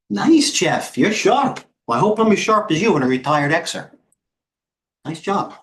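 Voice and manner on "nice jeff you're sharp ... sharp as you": excited. Real, not acted. Praising a viewer's comment.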